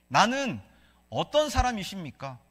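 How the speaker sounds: usual speaking voice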